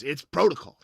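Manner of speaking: loud, high-pitched insistent guard; gate guard. Acted. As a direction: insist on the rules, exasperated. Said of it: "And the guy goes".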